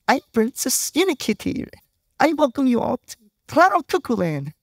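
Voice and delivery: high, sugary unicorn kitty voice; Princess Unikitty voice. Retold or imitated. Preacher quoting the movie: high cartoon princess voice. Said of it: "This unicorn kitty hybrid".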